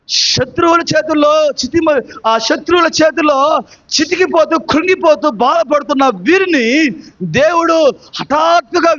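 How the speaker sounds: driving, phrase-by-phrase sermon delivery; impassioned preaching. Real, not acted. Preacher telling the congregation how God saved Israel, impassioned preaching.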